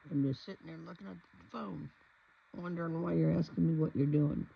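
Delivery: loud, sassy cat voice; voicing the cat. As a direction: speak for the cat, deadpan. Voicing the cat's reply.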